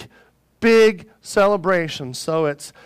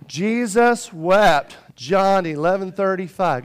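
slow, emphatic verse recitation; leading a recitation; slower than usual. Shown as a demonstration. Preacher teaching the congregation a short verse, slow recitation. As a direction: spell it out slowly for a group to repeat. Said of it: "Say it".

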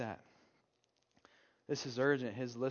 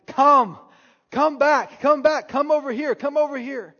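high, loud urgent yelling; rescuing yell; 11 semitones higher, 15 dB louder than usual. Retold or imitated. Parent yelling to a child on the train tracks, frantic shout. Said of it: "It's this rescuing type tone".

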